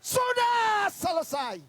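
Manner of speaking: high, drawn-out full shout; loud proclamation with long-held vowels; triumphant shout. Acted. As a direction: cry it out slowly at full voice. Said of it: "Dia teriak".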